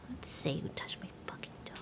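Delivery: hushed menacing whisper; threatening whisper. Acted. Streamer muttering a threat at the sim, whispered. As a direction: breathe the threat, no voice.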